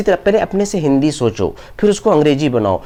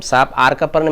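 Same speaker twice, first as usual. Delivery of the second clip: ordinary talk, nothing marked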